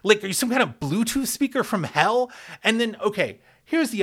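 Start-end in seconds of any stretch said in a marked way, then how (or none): 0.0-2.3 higher, mock-baffled what do you want; baffled retort. Host replaying his bewildered reply to chanting fans, higher. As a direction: sound bewildered and put upon. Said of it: "you're just like".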